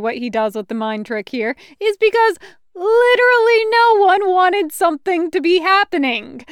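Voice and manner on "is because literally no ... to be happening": breaking into laughter mid-sentence; words squeezed through a laugh, higher and louder. Real, not acted. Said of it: "laughing while explaining".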